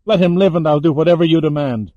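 fast, loud, high-pitched desperate plea; begging. A narrator's reading. Captive bargaining for her friend's life, begging. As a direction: plead in a breakneck rush. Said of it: "Lauren begged".

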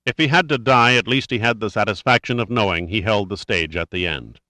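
usual speaking voice

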